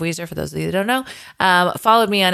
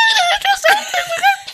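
giddy high laugh burst; high squealing laugh. Retold or imitated. Fan laughing as she spots her idol. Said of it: "Like that".

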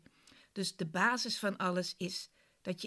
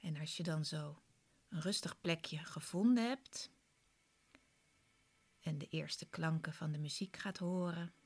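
guided meditation. Acted. Leads a guided relaxation.

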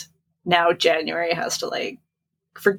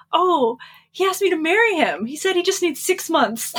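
high, bright mock-innocent mistress; hammed-up naive other woman; 10 semitones higher than usual. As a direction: play the clueless mistress, pitched way up. Mistress voiced in retold show.

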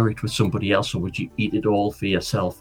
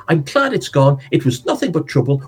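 higher-pitched, fretting mum; Mum's voice; 6 semitones higher than usual. Acted. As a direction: voice the mother, high and worried.

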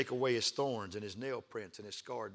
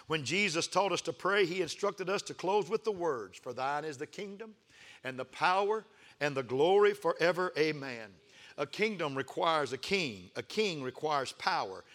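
rising preacher's cadence; preaching; 5 semitones higher, 4 dB louder than usual. Acted. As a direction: build the sermon up. Preacher building up.

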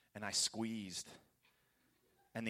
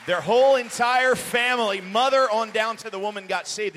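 loud, high-pitched proclamation; exultant pulpit shout; full-force preaching; 10 semitones higher, 16 dB louder than usual. Real, not acted. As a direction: belt out the triumph to the congregation.

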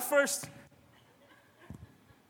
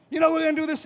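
higher, louder, keen mock enthusiasm; eager pitch; 7 semitones higher, 7 dB louder, faster than usual. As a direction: pitch it to the pastor all fired up. Eager member pitching an idea.

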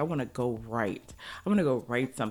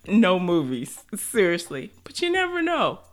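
laughing excitement; 5 semitones higher, 9 dB louder than usual. Real, not acted. Joking about future movie.

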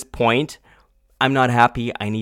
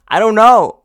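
much higher, louder "I don't know"; frustrated outburst; 11 semitones higher, 13 dB louder than usual. Real, not acted. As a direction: blurt "know" high in exasperation.

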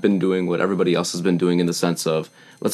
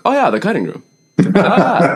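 high, louder awed reaction; impressed recognition; 11 semitones higher, 6 dB louder than usual. Retold or imitated. Friends hearing the venue's name, mock excited recognition.

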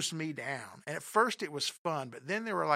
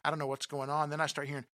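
usual speaking voice

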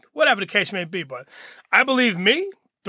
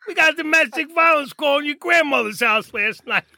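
higher-pitched cop reporting a call; imitating friend's voice; 7 semitones higher than usual. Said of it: "you know, his voice".

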